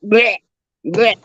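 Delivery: mock retching noise with a laugh; imitated gagging. Nephew gagging in the car, mock retching sound. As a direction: gag like a kid about to throw up. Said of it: "he starts the gagging".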